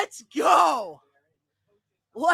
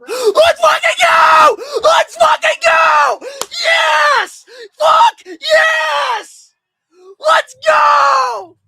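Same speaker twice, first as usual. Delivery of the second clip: high, loud, slow celebratory yells; ecstatic screaming; 9 dB louder, much slower than usual. Real, not acted. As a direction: scream with raw joy, voice cracking. Fan celebrating draft pick.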